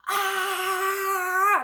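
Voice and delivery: mock full-lung scream, high and loud; a pretend cry of release at the top of her lungs; imitated scream. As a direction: belt out a fake frustrated scream. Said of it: "at the top of my lungs".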